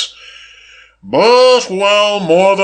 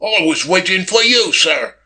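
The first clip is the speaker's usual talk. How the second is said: higher servant voice, hurried answer; hurried footman; 5 semitones higher than usual. A narrator's reading. Footman explains himself to Billy Kane, hurriedly. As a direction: answer the master hastily, deferentially. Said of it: "the man answered hurriedly".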